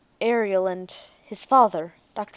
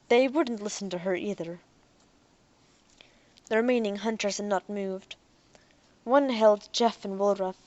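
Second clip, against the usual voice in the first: ordinary delivery, nothing marked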